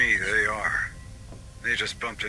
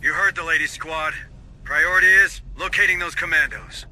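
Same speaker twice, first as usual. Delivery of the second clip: loud, higher command to the squad; rally the troops with a raised voice; barked squad orders; 6 semitones higher, 7 dB louder than usual. Acted. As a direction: sergeant calling out orders to his squad, loud and firm.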